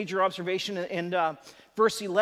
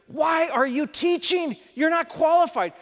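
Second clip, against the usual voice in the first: emphatic rebuke; 7 semitones higher, 6 dB louder than usual. Retold or imitated. Jesus confronting the teacher Nicodemus, an emphatic raised rebuke. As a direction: hammer home that he's unqualified. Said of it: "It's very emphatic in the Greek language".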